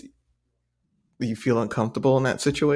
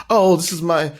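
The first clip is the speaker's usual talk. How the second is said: mock club guy; 13 semitones higher, 4 dB louder, slower than usual.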